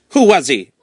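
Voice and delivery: a sharp, impatient snap; snapped. Acted. Marquis snapping at the road fixer, sharp and impatient. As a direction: bite the question off short. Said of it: "snapped the Marquis".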